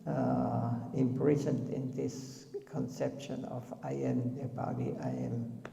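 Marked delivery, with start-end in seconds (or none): none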